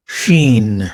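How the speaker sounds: شین with sh as in شمس; Arabic shin demo. Shown as a demonstration. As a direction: hush the sh in شین. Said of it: "جیسے شمس میں".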